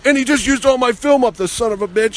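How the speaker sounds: high-pitched mock outrage; mad. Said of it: "And he's all mad at him".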